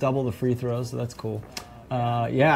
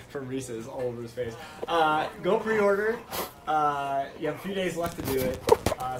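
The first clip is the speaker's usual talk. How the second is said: promo read; 4 semitones higher, 4 dB quieter than usual. Acted. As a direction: sell the pre-order like an ad.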